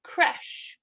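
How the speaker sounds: "Creche" as KRESH, one syllable ending in sh; copied pronunciation. Shown as a demonstration. Teacher repeating after the dictionary app, copied pronunciation. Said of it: "Sometimes it'll play a pronunciation".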